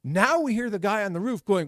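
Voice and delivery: usual speaking voice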